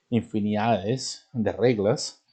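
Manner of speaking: ordinary delivery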